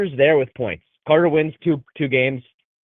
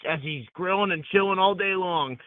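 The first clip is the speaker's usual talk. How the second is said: ordinary talk, nothing marked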